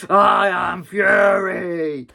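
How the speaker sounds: slow, loud put-on shout; slow hammed-up villain shout; villain roar. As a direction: bellow the name slowly. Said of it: "He's like".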